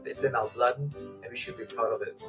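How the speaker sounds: ordinary talk, nothing marked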